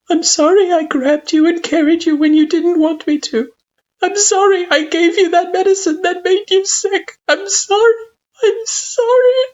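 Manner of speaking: very high, loud crying plea; tearful apology. A narrator's reading. Parent begs child's forgiveness, tearful and breaking down. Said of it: "you whisper".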